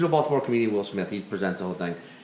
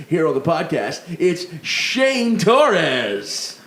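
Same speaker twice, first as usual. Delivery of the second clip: loud booming announcer; football announcer voice; 3 semitones higher, 4 dB louder than usual. Acted. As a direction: introduce the guest like a stadium announcer. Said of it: "You sound like a football announcer".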